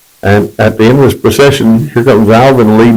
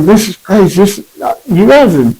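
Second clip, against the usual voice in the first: incredulous; 11 semitones higher than usual. Retold or imitated. Alvin suspects a prank.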